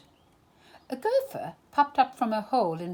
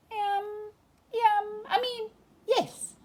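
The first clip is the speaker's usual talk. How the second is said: much higher, muffled, fumbling; dazed Pooh; 12 semitones higher than usual. A narrator's reading. Pooh under the honey pot answering Owl, dazed and high.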